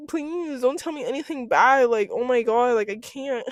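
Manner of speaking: high-pitched, dramatised dread; mock pleading. Patient dreading bad news from the doctor, mock pleading.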